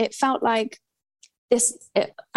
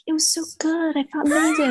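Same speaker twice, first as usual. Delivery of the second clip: gushing classmates; 6 semitones higher than usual. Retold or imitated. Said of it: "And everyone's like".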